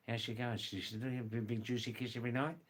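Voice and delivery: fast, run-together Australian colloquial question; quick casual Australian slang example; rapid Australian English. Shown as a demonstration. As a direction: rattle it off like a local, rising at the end. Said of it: "words in Australian English".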